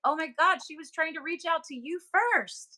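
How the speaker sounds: high, wide-eyed revelation; light up with the sudden connection; excited realization. Retold or imitated. Telling mom the spirit reached out to her first, excited. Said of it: "And I was like".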